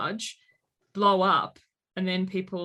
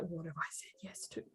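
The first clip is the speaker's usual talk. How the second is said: hushed unvoiced whisper of regret; whispered dismay; 4 semitones lower, 17 dB quieter than usual. Parent realising what the kids got away with, whispered. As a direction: whisper it to yourself, suddenly worried. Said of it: "and then you're like".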